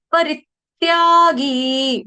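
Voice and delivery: परित्यागी drawn out slowly, stress on री; slow syllable-by-syllable demo. Shown as a demonstration. Teacher sounding out a Sanskrit word for the class, slowly. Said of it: "ये word आपने सुबह भी सीखा था".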